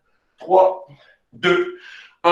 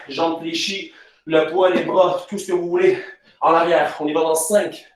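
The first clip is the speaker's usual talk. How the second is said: normal talking voice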